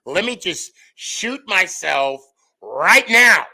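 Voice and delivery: hammed-up agony at a dull class; mock despair. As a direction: plead melodramatically out of boredom. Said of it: "I'm like".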